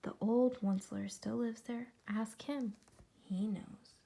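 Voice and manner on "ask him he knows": usual speaking voice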